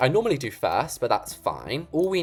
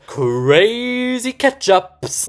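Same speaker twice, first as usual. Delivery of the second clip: high, loud, drawn-out mock excitement; hammed-up hype; 10 semitones higher, 8 dB louder, much slower than usual. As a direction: stretch it out like a wild announcement. Hammed-up product name while decorating.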